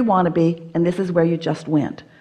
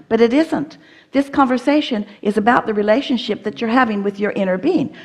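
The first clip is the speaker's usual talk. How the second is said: ordinary talk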